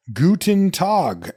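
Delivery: over-careful classroom German greeting, drawn out; stiff textbook German. Retold or imitated. A student greeting a German person, stiff and slow. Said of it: "you're probably going like".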